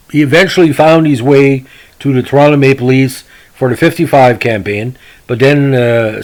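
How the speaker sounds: ordinary delivery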